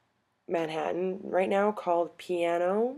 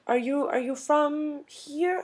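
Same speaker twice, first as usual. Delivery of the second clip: high, slow imitation of an old European lady; 9 semitones higher, slower than usual. Old lady asking strangers' origins, high and slow. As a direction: mimic a curious little old lady, drawn out. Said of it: "Eastern European-ish".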